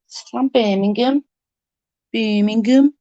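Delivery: badly attempted Birmingham accent, rising at the ends; Brummie accent. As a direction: put on a rough Brummie lilt. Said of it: "I'm not very good with accents".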